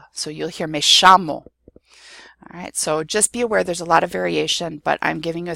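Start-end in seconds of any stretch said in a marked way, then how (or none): 0.7-1.5 "shamo" said SHAH-mo, loud and clear; Argentine pronunciation. Shown as a demonstration. Teacher showing what you'll hear in Argentina, "shamo" with sh. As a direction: turn the ll into sh, "shamo". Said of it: "in Argentina".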